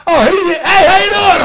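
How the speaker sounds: loud, very high-pitched greeting; mock calling out. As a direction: imitate an embarrassing dad hailing someone. Dad hails quarterback across room, retold.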